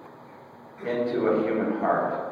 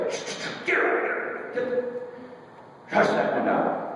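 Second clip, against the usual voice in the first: whispered "shh, shh", shooing her away; hushing whisper; 4 dB quieter, slower than usual. Retold or imitated. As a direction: whisper sharply, brushing her off. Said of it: "The disciples say".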